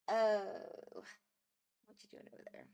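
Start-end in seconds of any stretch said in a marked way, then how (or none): 1.9-2.6 soft whisper, no voice. Real, not acted. Whispering to the pet over there, hushed. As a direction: breathe the question to the pet.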